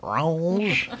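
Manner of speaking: lightly put-on French accent on "Range"; mock French accent. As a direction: say "Range" like a hammed-up French speaker. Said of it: "make fun of my Lumiere accent and then say".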